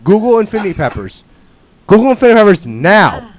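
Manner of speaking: loud, high, insistent command; excited urging. Real, not acted. Urging the hosts to search it, excited and loud. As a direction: push them to look it up now.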